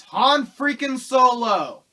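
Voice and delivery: slow loud high shout of Han freaking Solo; loud, slow, incredulous reveal; exasperated shout. Real, not acted. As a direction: hammer the punchline in disbelief. Punchline reveal.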